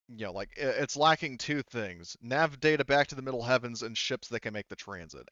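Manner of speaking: ordinary talk, nothing marked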